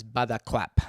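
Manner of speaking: "buttercup" as "butterclap" with an added W sound. Shown as a demonstration. Coach shows Peter Cook's "butterclap". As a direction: say "butterclap" on purpose. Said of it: "He adds a W to the word buttercup".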